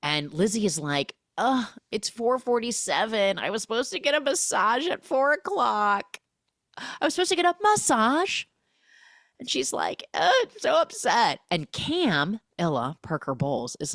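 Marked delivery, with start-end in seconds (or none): 1.4-8.4 high, nasal-free whine, pitched well up and rising; mock the pampered bride moaning about her missed massage; whiny spoiled bride. Spoiled bride whines to castaways about her massage. 10.1-11.4 high, louder mock pout; a hammed-up spoiled sulk; pouty whine. Spoiled bride sulks to the group, high whine.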